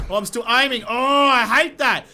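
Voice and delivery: loud, high, drawn-out groan of frustration; exasperated outburst; frustrated yell. Real, not acted. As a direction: yell at the game. Frustrated gamer yelling at controls.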